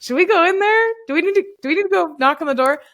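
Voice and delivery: nervous, wondering if they should knock; worried whisper-free fretting. Retold or imitated. Couple outside the bathroom fretting about the stranger, nervously high.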